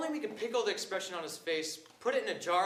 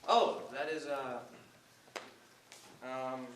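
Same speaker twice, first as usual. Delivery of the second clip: halting, lower-pitched hesitation; stammering; 8 semitones lower, 3 dB quieter, much slower than usual. Acted. Nervous student before impatient surgeon, stammering. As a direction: grope for words with long pauses. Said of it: "listening to you stammer".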